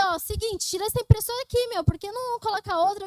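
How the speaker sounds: high, heated re-enactment of telling off the boss; worked-up mock outburst; retold outraged demand. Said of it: "eu fiquei revoltada".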